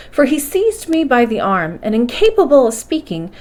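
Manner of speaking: usual speaking voice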